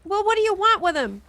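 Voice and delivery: high, loud shouted question; calling up. Acted. Owl's owner questioning the lookout, calling up loudly. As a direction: holler it up to someone overhead.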